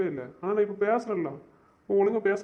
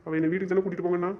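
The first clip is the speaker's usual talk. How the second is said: ordinary talk, nothing marked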